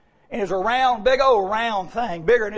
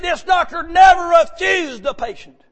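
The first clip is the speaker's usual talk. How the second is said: high, loud, slow, stressing "never"; preaching shout; 8 semitones higher, 5 dB louder, slower than usual. Real, not acted. Preacher assures the troubled man in a high, slow shout.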